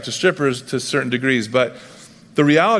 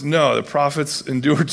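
ordinary talk

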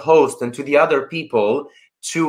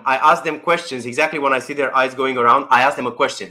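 ordinary delivery

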